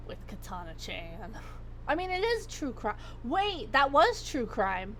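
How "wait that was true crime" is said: normal talking voice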